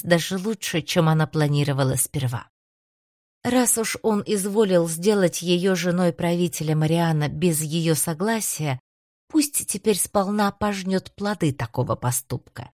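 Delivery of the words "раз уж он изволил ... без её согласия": plain reading voice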